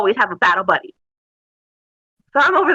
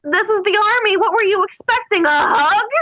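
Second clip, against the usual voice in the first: higher, mocking disbelief; sarcastic retort; 8 semitones higher than usual. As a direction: scoff at her complaint. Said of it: "And I'm like".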